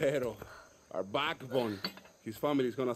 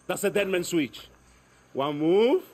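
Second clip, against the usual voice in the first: louder, higher threat; sharp warning; 5 semitones higher, 6 dB louder than usual. Acted. Guerrilla warns Dani about the dead man switch, loud threat. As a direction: bark the warning hard.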